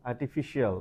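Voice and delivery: "artificial" ending "shee-al"; mispronounced variant. Shown as a demonstration. Teacher giving another version we say, mispronounced. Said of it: "আমরা বলি".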